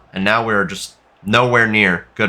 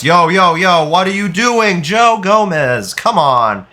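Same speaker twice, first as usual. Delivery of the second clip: drawn-out, pitched-up disbelief at his defender; exasperated yelling; 6 semitones higher, 3 dB louder, slower than usual. Real, not acted. Yelling at his own defender for giving away a penalty, exasperated. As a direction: stretch out the words as if you can't believe the foul. Player fouls, he yells in disbelief.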